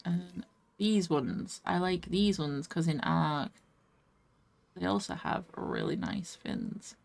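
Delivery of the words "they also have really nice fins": normal talking voice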